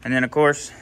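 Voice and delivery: ordinary talk, nothing marked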